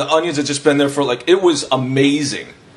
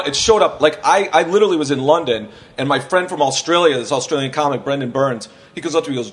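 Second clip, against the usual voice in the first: usual speaking voice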